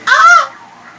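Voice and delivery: sudden high shriek of fright; startled scream. Real, not acted. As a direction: scream in real shock. Jump scare in horror game.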